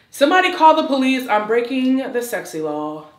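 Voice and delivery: loud playful cry for help, easing off; mock emergency call.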